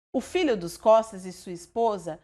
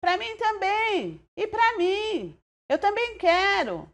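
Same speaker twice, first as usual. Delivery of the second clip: shouting; 9 semitones higher than usual. A narrator's reading. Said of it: "mais três vozes gritando".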